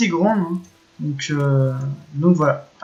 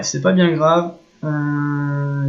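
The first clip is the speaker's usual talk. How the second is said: ordinary delivery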